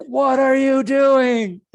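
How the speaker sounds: high, loud, slow panicked shout; high slow shouted quote of a panicked friend. Retold or imitated. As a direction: yell it at him in alarm. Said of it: "Wendy's like".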